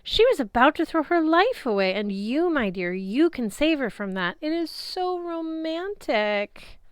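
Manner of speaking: high, slow, swooning love goddess; lightly voiced goddess, drawn-out and dreamy. A narrator's reading. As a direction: sigh over the romance of it all. Aphrodite swooning over romance.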